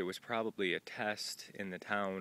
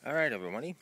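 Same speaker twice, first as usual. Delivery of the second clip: talking around a lollipop; candy in mouth; mouth full; 6 semitones higher, 6 dB louder, much faster than usual. Real, not acted. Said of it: "I had a sucker in my mouth".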